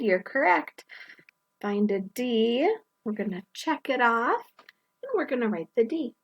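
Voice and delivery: usual speaking voice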